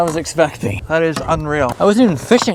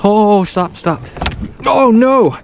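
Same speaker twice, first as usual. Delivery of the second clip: alarmed; 6 semitones higher, 4 dB louder, slower than usual. Real, not acted. Fish thrashing, ruining clothes.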